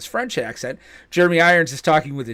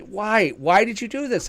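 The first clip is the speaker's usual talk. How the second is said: high, plaintive mock protest; hurt complaint, pitched up; upset wife; 7 semitones higher than usual. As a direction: imitate an upset wife demanding a reason. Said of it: "she got very upset at me".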